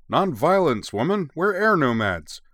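mock protest. A narrator's reading. Husband pretending to cower from his wife, mock protesting. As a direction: cry out in playful indignation. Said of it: "Masato protested, pretending to cower".

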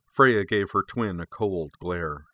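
ordinary delivery, nothing marked

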